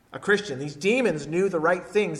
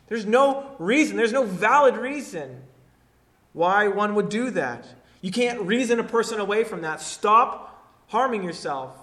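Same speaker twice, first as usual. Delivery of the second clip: louder preaching, driving each point home; forceful preaching; 5 semitones higher, 6 dB louder than usual. Acted. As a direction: hammer it home to the congregation.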